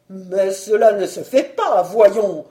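loud indignant objection; scandalised protest. Acted. Baron protests to his wife over his title, loud indignation. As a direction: object loudly, aghast.

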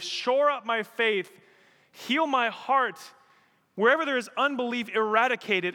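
a preacher crying out in passionate prayer; fervent prayer. Shown as a demonstration. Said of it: "how often I pray that prayer".

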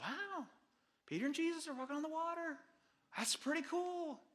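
high, soft voice of wonder; hushed, high-pitched pretend awe; mock amazement. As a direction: play a mildly impressed onlooker. Acting out impressed, easily satisfied listeners.

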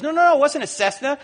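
high, urgent imitated denial; agitated correction. Pastor correcting his friend on the phone, high agitated protest.

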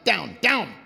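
loud "down" dropping sharply from high; forceful demo "down", pitch falling hard; angry-sounding falling tone. Shown as a demonstration. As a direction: punch each "down" as if angry. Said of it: "sounds like you're angry or upset".

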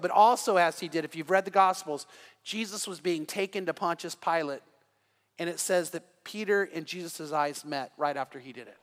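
ordinary delivery

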